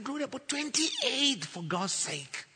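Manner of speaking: slow, high disbelief, dropping at the end; exasperated protest. Retold or imitated. Husband to wife over twenty-eight children, exasperated. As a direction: stretch the number out in exasperation.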